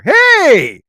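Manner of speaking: high, loud "caught in the act" hail; shouted greeting. Pretend. Host realizes he is on air, shouted greeting. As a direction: shout a big sudden hello.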